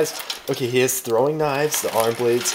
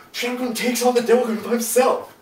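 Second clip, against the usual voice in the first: much higher and louder, hyped insistence; excited boast; 11 semitones higher, 6 dB louder than usual. Real, not acted. Friend hyping his favorite Gundam in an argument, excited and loud. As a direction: sell it like an unbeatable fact. Heated debate over strongest mecha.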